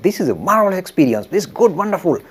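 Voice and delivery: bursting with joy after the dream; excited. Retold or imitated. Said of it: "was very excited".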